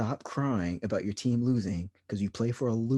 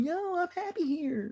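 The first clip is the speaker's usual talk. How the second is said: mock happy player. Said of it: "y'all be like".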